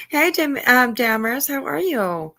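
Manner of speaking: usual speaking voice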